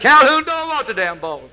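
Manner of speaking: high acted holler as a punchline; exasperated player's retold yell; quarterback yelling back. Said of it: "quarterback turned around and says".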